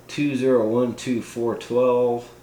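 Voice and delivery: ordinary talk, nothing marked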